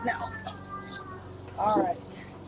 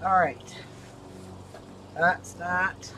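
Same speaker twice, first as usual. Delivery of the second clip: ordinary talk, nothing marked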